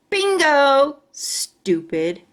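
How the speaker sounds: loud jeering shout at the classmate. A narrator's reading. Jim mocking the girl across class, loud jeer. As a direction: bark it out like a mean boy. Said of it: "he shouted".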